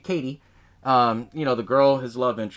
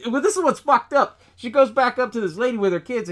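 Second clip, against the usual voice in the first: usual speaking voice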